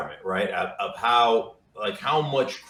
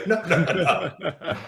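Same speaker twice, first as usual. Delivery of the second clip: mock protest through laughter, higher; laughing protest; 8 semitones higher, slower than usual. Guest retelling his denial to the quarterback, laughing protest. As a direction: laugh off the claim with a retold denial.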